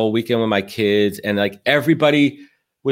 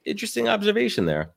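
mock bemused reaction; 12 semitones higher, 4 dB quieter than usual. Telling listeners how to react to Trump, high mock bemusement. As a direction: play it wry and high, pausing mid-line.

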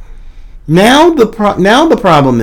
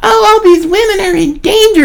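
high, whiny mock complaint; mock whining. Mocking women who complain, in a high whining voice. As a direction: parody the complainers in a shrill-free high whine. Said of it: "the whining and the griping".

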